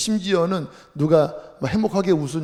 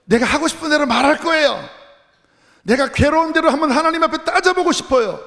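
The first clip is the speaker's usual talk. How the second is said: high, loud protest to God; pouring out complaint; 11 semitones higher, 5 dB louder than usual. Acted. Preacher voicing Job arguing with God, pouring out bitter complaint. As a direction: cry out in aggrieved, accusing outburst. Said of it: "원망과 불평이 막 쏟아져 나옵니다".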